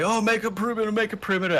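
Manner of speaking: high-pitched mock shouts of alarm; high, hurried imitation of men yelling. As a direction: play panicked guys yelling orders, without real volume. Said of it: "these guys are all yelling".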